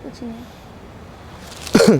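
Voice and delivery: very slow, near-breathless choked words; crying. Acted. Hiding her crying from the groom-to-be, choked and barely voiced. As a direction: let the sob swallow the voice. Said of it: "अबे रो क्यों रही है?".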